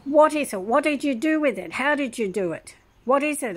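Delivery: retold yelling nurse. Nurse grilling patient about the cure, mock yell. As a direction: fire off questions like an angry nurse. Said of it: "she kept yelling at me".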